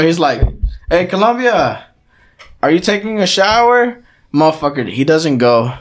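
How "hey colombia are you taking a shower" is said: raised voice, lightly imitated dad; calling through door. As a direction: call to someone behind a bathroom door. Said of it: "my dad knocks on the door".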